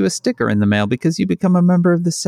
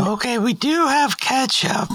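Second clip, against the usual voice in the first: put-on high, polite teen cashier; chirpy drive-thru kid behind the counter; fast food cashier voice; 4 semitones higher than usual. McDonald's cashier roleplay.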